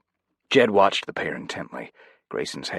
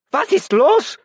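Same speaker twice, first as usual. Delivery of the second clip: very high, loud German shriek; very high, loud panicked German shriek; 10 dB louder, slower than usual. A narrator's reading. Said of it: "Veeder shrieked, panic in his voice".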